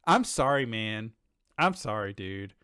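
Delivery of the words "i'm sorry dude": ordinary talk, nothing marked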